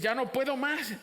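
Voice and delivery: high-pitched cry of giving up, acted; desperate surrender. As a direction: cry it out at the end of your strength. Voices a believer giving up.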